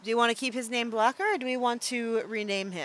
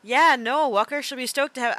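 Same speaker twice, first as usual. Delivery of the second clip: put-on half-hearted Australian accent, higher and louder; attempted Australian accent; 6 semitones higher, 8 dB louder than usual. Streamer attempting Walker's voice, abandoned mid-sentence, shaky Australian accent. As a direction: try an Australian accent, not convincingly. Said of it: "I can't do an Australian accent".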